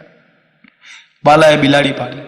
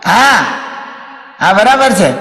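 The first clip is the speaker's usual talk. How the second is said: higher-pitched calling into a mobile; 5 semitones higher, slower than usual. Acted. Man answers mobile at cremation ground, high phone voice. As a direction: call out short replies high into the phone.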